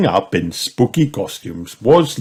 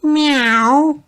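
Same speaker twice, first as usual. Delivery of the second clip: high, loud cat meow; 5 dB louder than usual. A narrator's reading. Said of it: "purred Peppa".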